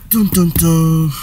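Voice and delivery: slow, drawn-out suspense sting; mock dramatic drumroll voice; dramatic dun dun dun. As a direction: sing it like a movie reveal. Dramatic suspense before announcing date.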